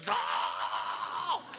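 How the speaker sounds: loud wailing cry of a possessed camper; imitated possessed wail. Teen at revival camp imitating possessed kids, loud wail.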